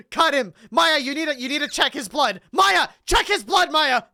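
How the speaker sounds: frantic courtroom yelling; urgent shouting. Acted. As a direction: shout at her to check his blood now. Urging Maya during the trial.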